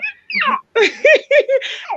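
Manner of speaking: a high real laugh; high real laugh after a joke; high laughing burst. Real, not acted. Laughing at own outburst.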